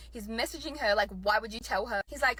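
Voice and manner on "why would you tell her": ordinary talk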